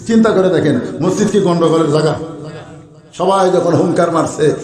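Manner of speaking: ordinary talk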